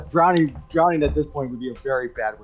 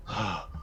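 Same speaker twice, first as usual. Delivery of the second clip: soft, low exhausted sigh; acted drained, breathy exhale; laboured breath; 7 semitones lower, 14 dB quieter than usual. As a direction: strain to take a breath. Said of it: "trying to take a breath".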